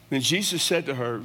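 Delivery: ordinary delivery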